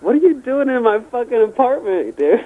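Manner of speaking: retold outraged question, pitched high; startled indignation. Retold shock at intruder.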